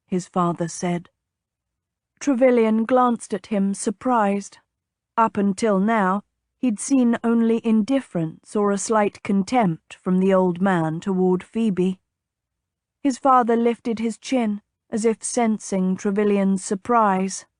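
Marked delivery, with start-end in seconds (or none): none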